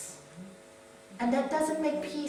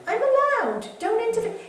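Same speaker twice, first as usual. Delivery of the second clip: playful mock telling-off; 10 semitones higher, 8 dB louder than usual.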